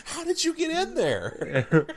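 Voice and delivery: high, slow mock wonder; mock amazement. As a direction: ask the lobster in awe. Talking to imaginary lobster.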